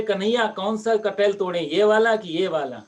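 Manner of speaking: loud, high call from up the tree; calling out loudly. Acted. Sadhu up the tree loudly asks Kanhaiya which jackfruit to pick. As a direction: shout the question down, forgetting the gardener is asleep. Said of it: "अब इतना जोर से बोले".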